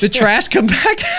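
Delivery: high, loud, amused repeat; delighted echo. Real, not acted. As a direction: relish the silly name. Echoes move name, amused.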